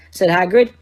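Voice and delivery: ordinary delivery, nothing marked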